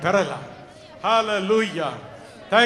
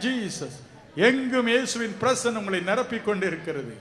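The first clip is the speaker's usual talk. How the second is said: ordinary talk, nothing marked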